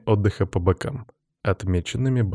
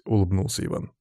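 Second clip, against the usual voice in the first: usual speaking voice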